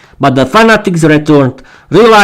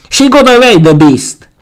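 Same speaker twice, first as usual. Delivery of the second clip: snarled; 8 semitones higher than usual. A narrator's reading. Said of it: "one of them snarled".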